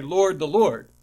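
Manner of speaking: "Lord the Lord" said loud, falling on second "Lord"; loud contrasting title. Shown as a demonstration. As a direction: proclaim "Lord the Lord" to show the doubling. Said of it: "if we were consistent, we'd say".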